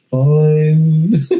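sulky robot, grudging; sarcastic robot monotone. Pretend. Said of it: "a really sarcastic robot".

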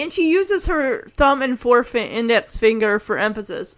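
normal talking voice

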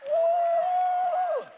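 high, loud preacher's whoop; excited whoop. Acted. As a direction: let out a joyful whoop.

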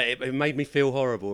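ordinary delivery

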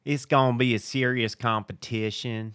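Mindy impression. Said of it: "that what Mindy said?".

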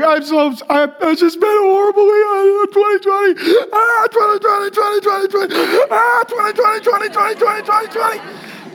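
exaggerated high-pitched wailing imitation; hammed-up sobbing that dissolves into babbled repetition; mock crying. Overwhelmed shopper breaks down to a stranger, hammed-up wailing. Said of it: "She starts crying".

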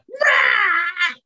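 high hammed-up shriek of a soaked kid; mock child scream. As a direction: squeal like a drenched toddler. Child screaming at cold water.

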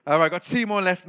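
ordinary delivery

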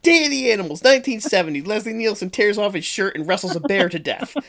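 laughing, victorious retelling; gleeful gloating. Retold or imitated. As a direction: crow over winning the argument. Gloating retold comeback to mom.

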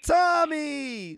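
high, loud, stretched-out holler; imitated woman calling out. Pizzeria woman greeting a regular, imitated as a high loud holler. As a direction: call across the shop way up high. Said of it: "She'd be like".

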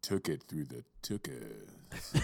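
low, slow sultry voice. Pretend. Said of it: "I tried to say it in my sultry voice".

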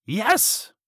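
high-pitched, delighted yes; storybook witch calling out keenly; excited witch's cry. A narrator's reading. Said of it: "cried the witch".

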